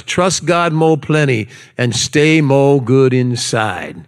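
slow, measured Hawaiian Pidgin; slow Hawaiian Pidgin sign-off; Hawaiian Pidgin accent. Acted. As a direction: wind down slowly in put-on Hawaiian Pidgin. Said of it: "in my best, uh, Pidgin accent".

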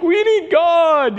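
high, slow mock wail; imitated weeping teenagers. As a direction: cry it out in an imitated tearful plea. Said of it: "They're weeping".

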